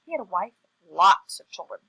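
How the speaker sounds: plain reading voice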